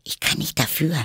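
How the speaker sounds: high, meek little protest; small, high apologetic character voice; modest. A narrator's reading. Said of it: "sagte Alice bescheiden".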